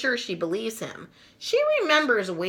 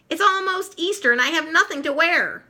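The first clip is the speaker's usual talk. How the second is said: whiny complaint; 9 semitones higher than usual. Acted. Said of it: "Sally cries".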